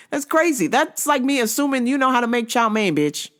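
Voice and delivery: ordinary talk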